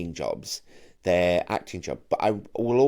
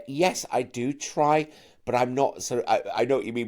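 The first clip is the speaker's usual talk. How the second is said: usual speaking voice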